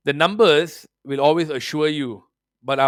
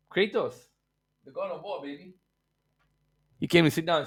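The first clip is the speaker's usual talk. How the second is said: usual speaking voice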